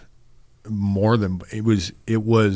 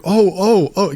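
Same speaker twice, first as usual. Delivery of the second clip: startled "oh"s, much higher and louder; caught off guard; 10 semitones higher, 5 dB louder, slower than usual. Retold or imitated. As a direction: imitate a friend thrown by a sobbing call. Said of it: "just so caught off guard".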